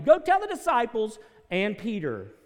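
loud, high ringing proclamation; shouted proclamation. Acted. Pastor proclaims the invitation to the crowd, shouted high. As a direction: belt the message out to the room. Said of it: "angel's message, shouted from the pulpit".

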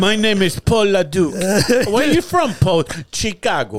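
lightly put-on Belgian accent; Van Damme impression. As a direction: play a bad action-movie hero introducing himself. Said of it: "he talks like this".

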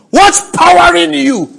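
loud, high shouted challenge; high, ringing pulpit cry; preacher's shout. Real, not acted. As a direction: fire the question out at full voice. Preacher fires a challenge at congregation.

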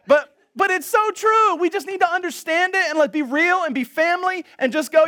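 high, loud, full-force sermon delivery; impassioned preaching. Real, not acted.

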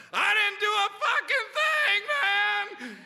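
high, loud, slow protesting shout; desperate teenage shout of innocence; screaming kid. Retold or imitated. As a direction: yell it like a kid being dragged off. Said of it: "kicking and screaming about".

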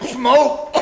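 usual speaking voice

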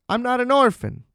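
put-on a small girl insisting "I'm not an orphan"; lightly voiced kid, simple protest; child protesting.

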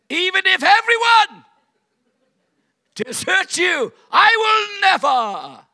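shouted high, slow and drawn out; Peter's loud boast. Acted. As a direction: belt out a proud vow of loyalty. Preacher voicing Peter's boast.

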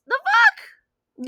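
high, loud disbelief; a shrill the fuck; incredulous what the. Retold or imitated. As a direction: squawk it in outrage. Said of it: "And I'm like".

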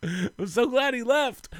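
very high, slow, squeezed with amusement; relieved fans. Retold or imitated. Couple relieved the stranger is gone, high gleeful imitation. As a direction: squeal it slowly as the gleeful fans. Repeats the imagined fans' relief.

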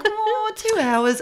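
high, drawn-out mock reply; imitating co-host's reply. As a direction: stretch it out high, mimicking your friend. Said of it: "you were like".

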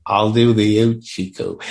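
slow imitated Cockney; Pansy's Cockney. Podcaster playing along with co-host's praise, slow mimicked Cockney. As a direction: stretch it out in mock Cockney. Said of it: "her Cockney accent when she talks like Pansy".

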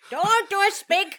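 Spick said loud and high as a mocking order; mock shout. Teasing his co-host over a made-up word, mock shout. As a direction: bellow it in mock outrage.

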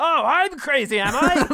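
high, loud, slow mock rant; crazed villain. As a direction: snarl it like a madman.

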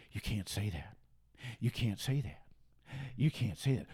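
a quiet, sneering chant; mock censor. Mocking what campus censors tell students, soft low mimicry.

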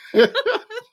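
high squealing laugh; high burst of laughter. Real, not acted. Caller teasing about wheezing.

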